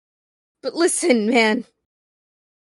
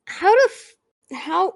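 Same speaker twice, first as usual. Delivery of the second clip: normal talking voice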